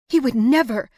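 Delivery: loud, high indignant cry. A narrator's reading. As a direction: fling the denial back. Indignant denial.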